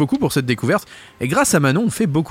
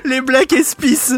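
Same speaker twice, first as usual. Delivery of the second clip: mock squeaky repeat; 10 semitones higher, much slower than usual. Co-host teasing the guest's slip in a very high slow voice. Mocking the mispronounced name.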